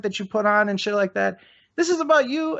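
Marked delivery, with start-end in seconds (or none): 1.8-2.6 high accusing push. Real, not acted. Heated accusation at guest.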